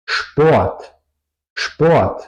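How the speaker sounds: "Sport" as shport, said clearly and apart. Shown as a demonstration. Teacher giving students an example, "Sport" as shport. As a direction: say "Sport" with a sh before the P. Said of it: "se pronuncia".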